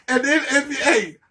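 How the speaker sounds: ordinary talk, nothing marked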